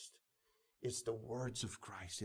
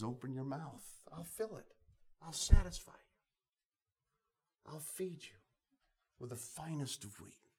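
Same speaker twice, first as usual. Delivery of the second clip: voicing God; 6 semitones higher, 3 dB louder, slower than usual. Preacher voicing God's promise.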